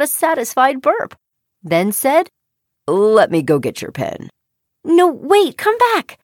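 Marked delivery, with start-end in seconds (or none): 2.9-4.3 low, calm frog; deep, easygoing frog. A narrator's reading. Frog offers to fetch pen. 4.8-6.2 high, urgent call; girl's high-pitched plea; calling after. A narrator's reading. Girl calls after departing frog.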